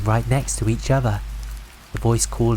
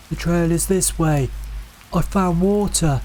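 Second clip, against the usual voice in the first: luring call; 6 semitones higher than usual. A narrator's reading. The voices in the woods luring the brothers, high and coaxing. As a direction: coax them off the path. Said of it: "saying things that sounded helpful".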